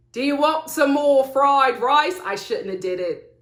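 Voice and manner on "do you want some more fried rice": louder, badly faked Australian dad; attempted Australian accent. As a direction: put on a terrible Aussie accent. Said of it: "to do an Australian accent".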